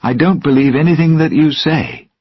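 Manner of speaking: Will shouting at Jack during the fight. Acted. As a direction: throw the refusal back at him. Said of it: "he shouted".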